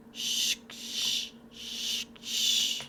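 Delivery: breathy eerie shh; whispered shushing. Acted. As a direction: hush someone softly in the dark.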